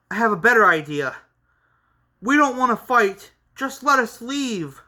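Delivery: pleading. Acted. Character pleads to avoid fight.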